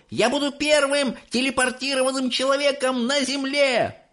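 put-on high, thrilled kid shouting; boy yelling. A narrator's reading. Mike yelling to all as he runs, thrilled. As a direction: show off to everyone. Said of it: "вопил он".

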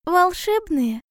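high, curious small-animal voice; wondering little hedgehog. Acted. Hedgehog asking owl about the birds, high curious voice. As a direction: ask it high, full of wonder.

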